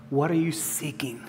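breathy whisper, barely voiced; hushed question. Jesus's question, quietly posed.

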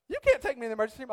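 high, loud, rapid-fire retold protest; mock objection. Sick couple arguing over who drives whom, fast mock objection. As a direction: rattle off an imitated objection at high pitch. Retold back-and-forth argument with wife.

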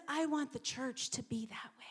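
hushed, intimate whisper. Acted. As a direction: breathe it out like a secret prayer. Jesus's wish, said softly first.

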